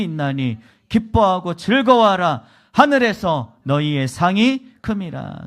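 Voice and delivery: reading scripture aloud together, loud and slowing at the end. Real, not acted. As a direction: proclaim the verse to the congregation. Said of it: "같이 한번 읽습니다".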